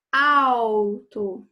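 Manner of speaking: "Alto" with AL loud and drawn out; stress on the first syllable. Shown as a demonstration. Teacher demonstrating the tonic syllable, AL loud in "Alto". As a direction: hit the "Al" of "Alto" hard and hold it. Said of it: "que é a sílaba tônica".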